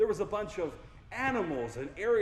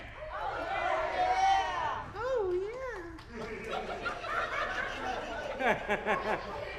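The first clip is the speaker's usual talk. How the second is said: high laughter; 14 semitones higher than usual. Real, not acted.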